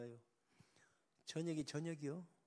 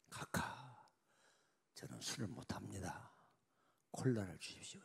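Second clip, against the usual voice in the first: quiet, lower polite refusal; soft refusal; 4 semitones lower, 5 dB quieter than usual. Acted. General quietly refusing the president's drink. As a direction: decline softly but firmly.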